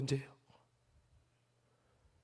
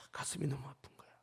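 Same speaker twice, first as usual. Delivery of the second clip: whispered low and soft; whispered aside about his aching heart; heartbroken whisper; 5 semitones lower, 10 dB quieter than usual. Real, not acted. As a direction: let the heartache drop to a whisper.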